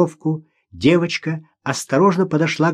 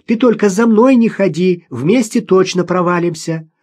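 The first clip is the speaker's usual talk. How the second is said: high, raised, carrying voice; shouting back; 6 semitones higher, 4 dB louder than usual. A narrator's reading. Girl on the ice warns her mother, calling out. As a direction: call the warning back from afar. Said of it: "крикнула в ответ Маша".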